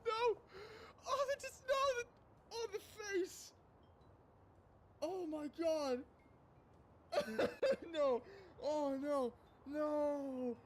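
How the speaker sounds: high loud shrieks of protest; shrieking dismay. Real, not acted. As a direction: scream in laughing horror. Shrieking at the cruel scene.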